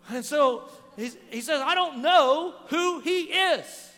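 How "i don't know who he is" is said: loud, high, very slow emphatic denial; cursing denial. Retold or imitated. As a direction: retell a vehement denial, hammering each word slowly. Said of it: "he begins to curse".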